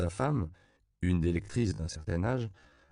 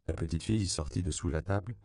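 plain reading voice

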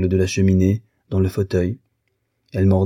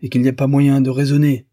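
higher, affronted declaration; offended huff; 6 semitones higher, 4 dB louder than usual. A narrator's reading. Husband looks for his hat, offended and huffy. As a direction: break off the quarrel in a huff.

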